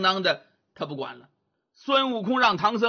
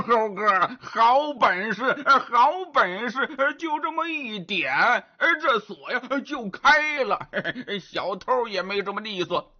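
grunting Zhu Bajie, delighted; 4 semitones higher than usual. Acted. Bajie admires Wukong's lock trick, gleeful grunting. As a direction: marvel with grunts and chuckles like a pig.

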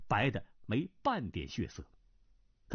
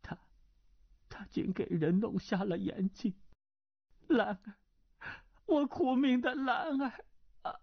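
murmured lament; 10 semitones higher than usual. Acted. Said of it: "喃喃的道".

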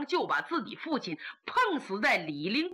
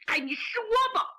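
choked urging; 10 semitones higher than usual. Acted. Bracing for bad news.

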